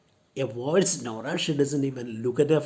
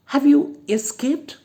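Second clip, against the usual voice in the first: very high, loud shocked question; alarmed surprise; 11 semitones higher, 8 dB louder than usual. Acted. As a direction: gasp the question out. Matilda alarmed at escaped convict.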